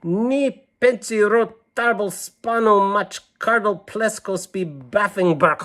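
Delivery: high, slow made-up secret language; sing-song nonsense words read out as the girl; gibberish code talk. Acted. Girl reads her secret code to the listeners, high and slow. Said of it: "she's saying, code that only I understand".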